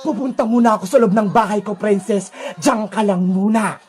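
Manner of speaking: deep-pitched, menacing captor; Sadako villain voice. Acted. Sadako leaving Princess behind, low and menacing. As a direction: order the captive to stay, pitched low.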